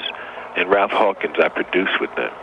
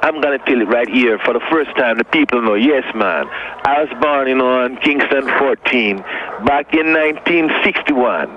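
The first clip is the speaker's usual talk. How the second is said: hammed-up Jamaican accent; 6 semitones higher than usual. Pretending to reveal he was born in Kingston, hammed-up Jamaican accent. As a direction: go big with a mock Jamaican accent. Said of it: "That was pretty good".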